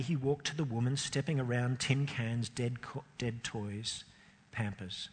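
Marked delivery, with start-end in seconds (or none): none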